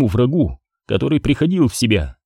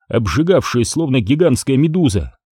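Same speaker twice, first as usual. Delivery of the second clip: usual speaking voice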